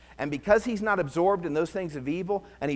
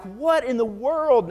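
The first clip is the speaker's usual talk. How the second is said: mock disbelief; 9 semitones higher, 7 dB louder, slower than usual. Shocked reaction to psalm.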